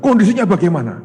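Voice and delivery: loud, high, anxious demand; urgent question. Retold or imitated. Frantic parent asking the friend about the son, loud and high. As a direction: push it out high and desperate.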